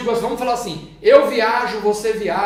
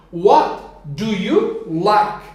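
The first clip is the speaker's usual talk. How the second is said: slow modeling; 5 dB louder, much slower than usual. Shown as a demonstration. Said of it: "teacher models English question".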